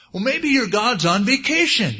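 high, loud, taunting jeer; gleeful sing-out taunt at rivals; mocking heckle. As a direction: heckle them in a high, rising voice. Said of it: "he just started mocking them".

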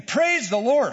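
a hammed-up excited praise; rising excitement. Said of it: "Says it again".

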